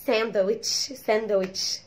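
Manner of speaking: "ساندويتش" as san-da-witch in Egyptian accent. Shown as a demonstration. Teacher showing our local way, Egyptian accent "ساندويتش" with d. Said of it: "عندنا احنا بالايجيبشن اكسنت".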